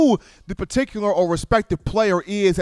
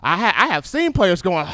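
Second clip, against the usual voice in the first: normal talking voice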